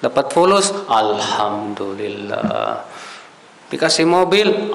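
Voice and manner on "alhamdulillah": drawn-out alhamdulillah. Retold or imitated. Mocking people thanking for wealth.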